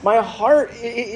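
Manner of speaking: usual speaking voice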